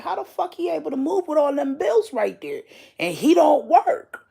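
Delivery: can't believe what you're seeing; disbelieving. Retold or imitated. Shocked at pastor's move in dream.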